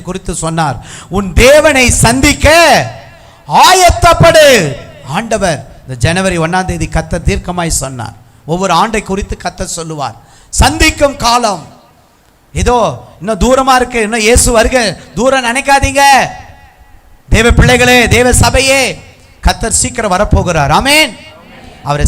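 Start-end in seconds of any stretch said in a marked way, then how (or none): 1.1-4.8 high, loud, very slow preaching cry; prophetic proclamation. Real, not acted. Preacher delivering God's command to prepare, drawn-out shout. 10.5-21.2 raised, high-pitched exhortation; urgent preaching. Real, not acted. Preacher warning the church of Christ's coming, high and urgent.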